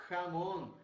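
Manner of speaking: jamón with a throaty Spanish j. Shown as a demonstration. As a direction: rasp the start of jamón. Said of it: "olha aqui".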